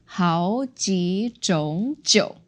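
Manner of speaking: slow 好几种酒 with 种 rising; second tone on 种. Shown as a demonstration. Teacher modifies the word for types, second tone demo. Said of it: "modified that 种 to second tone".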